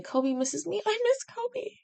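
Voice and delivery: put-on high, babyish whimper of longing; mock pining whine. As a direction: sigh it like a lovesick child. Missing a pet.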